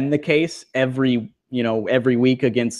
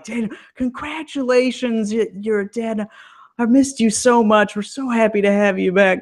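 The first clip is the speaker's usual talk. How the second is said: gushing, much higher voice; over-the-top suck-up; 10 semitones higher than usual. Retold or imitated. Natty gushing over Daniel, a much higher fawning voice. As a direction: lay the flattery on way too thick. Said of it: "oh, way over the top".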